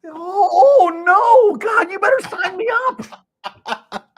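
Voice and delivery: high, loud, slow mock wail; mock despairing customer. Customer giving in to the salesman, mock wail. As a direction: ham up a defeated plea. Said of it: "she goes".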